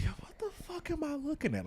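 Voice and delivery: very high, quick, thin-volume squeal of disbelief; retold disbelief. Reliving a sight through the glasses, high-pitched mock disbelief. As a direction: squeak it out, baffled. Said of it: "I literally said this out loud".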